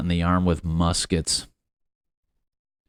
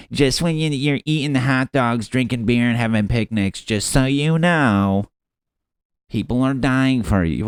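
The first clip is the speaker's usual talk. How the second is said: higher, louder, hammed-up scolding; mock preachy meme voice; 5 semitones higher, 5 dB louder than usual. Host mocking guilt-trip holiday memes, preachy voice. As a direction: lecture them sanctimoniously, stretching the reminder. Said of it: "you always see those memes".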